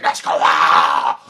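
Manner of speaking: low, loud, drawn-out roar; awed shout. Retold or imitated. Children seeing the matches brought out, awed shout. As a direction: bellow it slowly in amazement. Class excited over matches.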